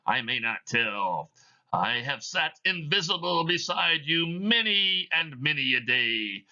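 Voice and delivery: a chain-bound spirit intoning its doom; Marley's ghost. Acted. Reads Marley's ghost in the play.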